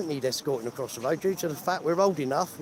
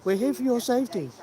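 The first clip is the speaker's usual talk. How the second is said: mocking quote; 8 semitones higher than usual. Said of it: "they said they're here for our safety".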